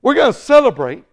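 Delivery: loud, high, quick announcement; celebrating voices. Acted. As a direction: announce the party eagerly. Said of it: "They're saying".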